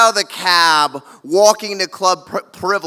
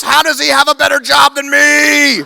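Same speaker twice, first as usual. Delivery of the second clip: indignant shout; 5 semitones higher, 4 dB louder than usual. Retold or imitated. High brother mocks guard.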